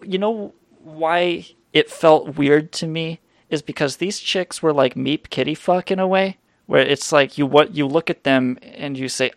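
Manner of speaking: ordinary delivery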